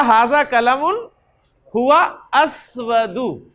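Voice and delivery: each word laid out slowly for the class; "हाजा कलमुन हुआ अस्वदु" said slowly, word by word; slow model sentence. Shown as a demonstration. Teacher spelling out the Arabic sentence slowly for students. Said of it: "तो मैं कहूँगा".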